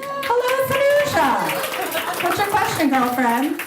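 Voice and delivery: drawn-out high-pitched hello; greeting a friend from the audience, stretched high and bright; warm high greeting. Real, not acted. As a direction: sing out the name to greet a friend.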